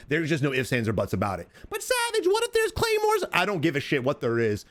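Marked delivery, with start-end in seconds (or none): 1.7-3.2 high squeaky mock viewer voice; mock whiny viewer. Coach mocking a viewer who fears claymores, high squeaky voice. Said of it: "mock viewer question".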